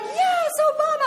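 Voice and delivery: high, loud cheer; cheering back at honking drivers; triumphant cheer. Retold or imitated. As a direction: shout it back like a fist pump. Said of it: "And I'm like".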